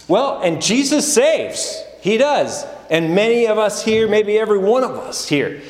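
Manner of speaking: a preacher's big proclamation of rescue; preaching at full force. Acted. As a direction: lift it up and proclaim it to the congregation. Preaching peak on Jesus saves.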